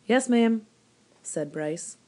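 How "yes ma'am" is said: put-on boy's reply. Boy answers the woman.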